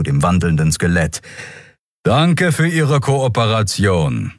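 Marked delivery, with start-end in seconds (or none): 2.0-4.3 higher, slow formal voice; drawn-out official thanks; official lieutenant. A narrator's reading. Lieutenant thanks skeleton informant, slow higher official voice.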